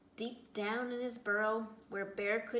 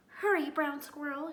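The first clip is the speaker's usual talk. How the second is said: calling out; 5 semitones higher, 6 dB louder than usual. A narrator's reading. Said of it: "she called".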